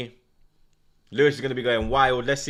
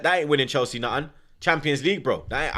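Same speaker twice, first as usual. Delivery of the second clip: normal talking voice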